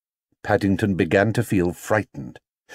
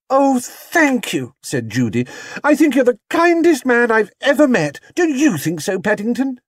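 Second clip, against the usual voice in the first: put-on gushing girl; 4 dB louder than usual. Judy gushing to inspector.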